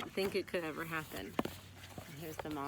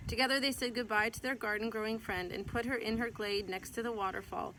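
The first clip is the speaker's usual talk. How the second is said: gentle, lively read-aloud voice; storybook reading; 4 semitones higher, 5 dB louder than usual. Acted. Mother reading a picture book to her child, gentle storytelling.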